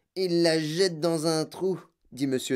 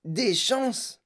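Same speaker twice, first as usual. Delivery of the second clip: mild howl; 10 semitones higher than usual. A narrator's reading. Madame Salt reacts to Wonka's reassurance, mild howl. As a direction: voice jumps up in mock-shrieked disbelief. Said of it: "hurla madame Salt".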